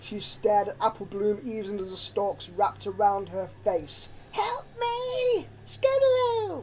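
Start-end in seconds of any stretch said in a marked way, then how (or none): 4.3-6.6 high, desperate cry; cry for help. A narrator's reading. Scootaloo being dragged into the corn, high terrified cry. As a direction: beg for help as you're dragged off. Friend dragged away screaming.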